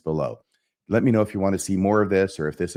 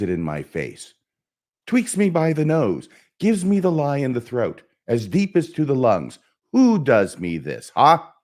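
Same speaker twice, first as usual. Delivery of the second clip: challenging questions, rising in pitch and force; Hamlet; 2 semitones higher than usual. Acted. Hamlet daring anyone to call him coward, building theatrical defiance. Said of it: "try and just perform the soliloquy here".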